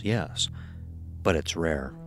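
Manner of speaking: ordinary talk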